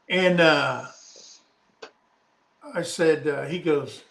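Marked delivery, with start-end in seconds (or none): none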